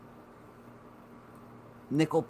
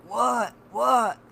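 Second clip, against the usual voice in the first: mom woken up; 9 semitones higher, 4 dB louder than usual. Retold or imitated. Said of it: "And she's like".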